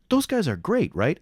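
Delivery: high eager question; enthusiastic. Retold or imitated. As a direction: rave about the actors. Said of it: "and I'm like".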